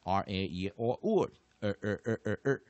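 curled-tongue R on every vowel, ending in repeated er; R-vowel drill. Shown as a demonstration. Teacher recapping both R-controlled sets, vowels then repeated er. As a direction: run through R A E or or, then punch out each er. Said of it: "它的发音是念".

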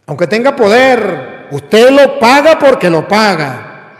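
sermón alto y fuerte, martillando cada frase; predicación a todo pulmón. Acted. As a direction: advierte a los laicos con fuerza de púlpito. Predicación encendida, amenaza a laicos. (predicación encendida, fuerte y enfática)

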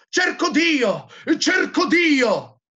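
high, loud theatrical cry; incessant shouting. A narrator's reading. Madman crying out in the market, loud high shout. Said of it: "si mise a gridare incessantemente".

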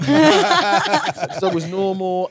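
slow, high, laughing through the words; can barely get it out for laughing. Real, not acted. Guest recalls the dog on set, laughing hard.

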